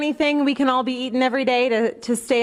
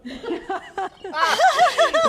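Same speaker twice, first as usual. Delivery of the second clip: anchor stifling giggles; 13 semitones higher than usual. Real, not acted. Giggling at old woman.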